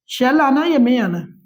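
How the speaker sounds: usual speaking voice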